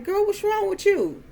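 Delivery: high-pitched startled question; alarmed aunt. Retold or imitated. Aunt asking the girl who just jumped in pain, alarmed. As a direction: voice leaps up, imitating a concerned aunt.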